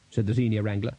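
ordinary delivery, nothing marked

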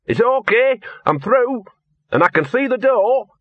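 high-pitched call through a gap; calling back. Acted. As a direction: call back that all is well, pitched right up.

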